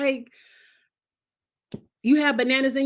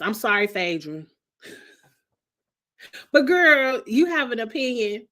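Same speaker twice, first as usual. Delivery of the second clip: ordinary talk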